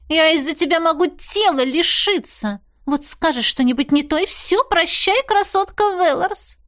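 high, agitated complaint, slowing at the end; agitated fretting. A narrator's reading. Cat warns she may lose her body, high and agitated. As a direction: fret in a high voice, drawing out the farewell.

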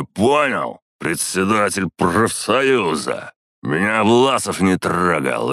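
put-on loud, high, slow drunken yelling; bully him with drunken rage; drunk rant. A narrator's reading.

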